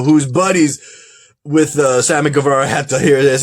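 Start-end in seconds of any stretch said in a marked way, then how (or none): none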